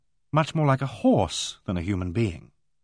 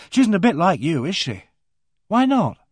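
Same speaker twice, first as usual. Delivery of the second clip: put-on higher, questioning girl's voice; curious young girl; 6 semitones higher, 4 dB louder than usual. A narrator's reading. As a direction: ask it bluntly like a child. Narrator voices Megan.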